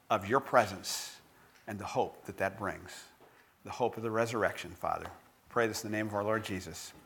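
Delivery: closing prayer. Acted. Pastor closes with prayer.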